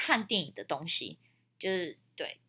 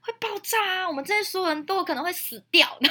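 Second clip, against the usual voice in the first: alarmed warning; 13 semitones higher, 6 dB louder than usual. Retold or imitated. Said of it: "我们就说".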